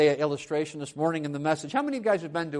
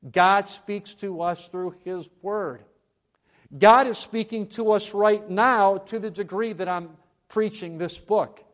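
louder, raised pulpit voice; emphatic preaching; 5 semitones higher, 8 dB louder than usual. Acted. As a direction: declare it with conviction to the congregation. Preacher at full force.

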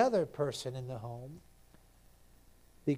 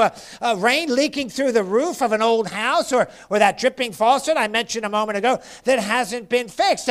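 raised, driving sermon delivery; insistent pulpit cadence, higher pitched; fervent preaching; 7 semitones higher, 10 dB louder than usual. Real, not acted. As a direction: pound the repetitions to show how it wears on you. Preaches at full force.

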